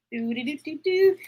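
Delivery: ordinary delivery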